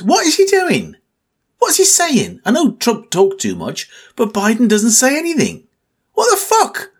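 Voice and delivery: high, exasperated disbelief about Biden; exasperated rant. Real, not acted. Vlogger ranting at politics, high exasperated disbelief. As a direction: throw the questions up in disbelief.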